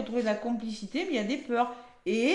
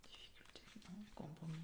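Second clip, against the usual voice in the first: whispered to herself; whispering; 6 semitones lower, 27 dB quieter, faster than usual. Real, not acted. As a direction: breathe the words without voice. Muttering card meaning to herself.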